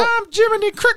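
squeaky cricket voice. Pretend. Joking that robots hop like grasshoppers, a squeaky cricket voice. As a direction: play a tiny cartoon cricket.

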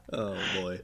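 ordinary talk, nothing marked

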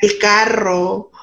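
El carro, the rr in carro rolled long and slow; rolled double R demo. Shown as a demonstration. Guest demonstrating the trilled rr of carro, slow and deliberate. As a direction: say carro slowly, trilling the rr hard. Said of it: "a la doble R".

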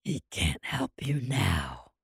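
low, slow demonic growl. Acted. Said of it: "traumatize you with my demon voice".

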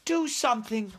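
put-on high-pitched plea from a frightened girl; urgent cry. A narrator's reading. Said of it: "she cried".

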